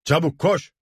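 high, urgent call; urgent order. A narrator's reading. Rebels hurry the messenger off, calling urgently. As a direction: send him running at once.

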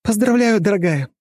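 high, loud happy cry; joyful squeal. Acted. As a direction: squeal the congratulations with joy. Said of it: "С радостным визгом".

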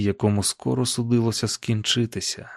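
plain reading voice

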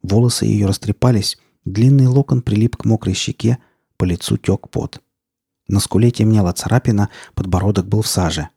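ordinary delivery, nothing marked

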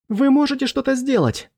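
high-pitched desperate plea; panicked wail. A narrator's reading. Hennon begs the professor to act, high panicked wail. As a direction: cry out high, begging for action, without extra volume. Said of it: "завопил Хеннон".

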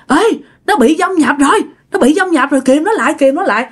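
retold alarmed neighbour rallying help; urgent warning. Neighbour warns of possession. (giọng hốt hoảng hô hoán)

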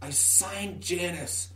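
hushed whisper close to the ear. Acted. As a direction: whisper the secret name. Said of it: "whisper into your ear".

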